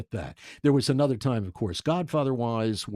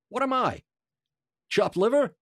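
high, mock-offended New York jab; indignant quip; 11 semitones higher, 4 dB louder than usual. Actor complaining from behind him, mock-offended. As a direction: toss it off like a wounded wiseguy. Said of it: "I hear".

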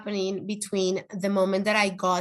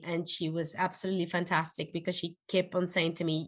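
ordinary talk, nothing marked

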